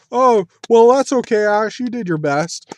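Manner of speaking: high, loud consoling voice, easing off; mock pitying crowd. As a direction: imitate a crowd offering pity applause. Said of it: "It sounded like pity applause".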